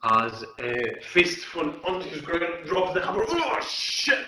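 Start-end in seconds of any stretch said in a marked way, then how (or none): 3.3-4.2 pained exclamation. Acted. Half-orc hit in the groin.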